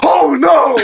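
high mock wail; dismayed fan. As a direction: groan like a losing fan. Crowd reacting to botched surgery.

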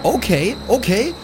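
high, heated taunting; heated challenge. Acted. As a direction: square up for a fight. Challenges him to fight.

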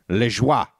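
Lejoie said "luh-ZHWAH"; French pronunciation. Shown as a demonstration. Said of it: "bring back the French pronunciation".

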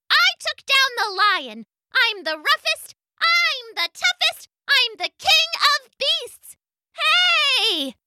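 very high, boastful bug voice; bossy gnat voice. Acted. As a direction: strut like a tiny tyrant.

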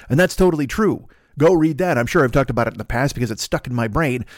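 ordinary talk

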